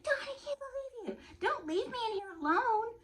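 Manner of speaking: very high-pitched mock whine; mimicked protesting friend. Friend begging her not to leave, high mock whine. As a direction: wail it in a squeaky friend voice.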